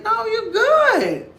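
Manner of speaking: high, slow, laughing reassurance; mocking laugh. Cousin teasing the drunk woman, laughing and high. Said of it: "she laughing at her".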